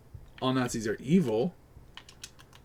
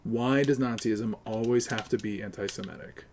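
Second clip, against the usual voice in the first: ordinary talk, nothing marked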